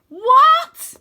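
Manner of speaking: high, loud outraged shout; loud stunned shout. A narrator's reading. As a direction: yell in disbelief. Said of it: "I shout".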